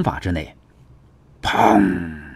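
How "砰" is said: long, ringing vocal "peng"; imitated impact boom. A narrator's reading. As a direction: voice a blast with a drawn-out tail.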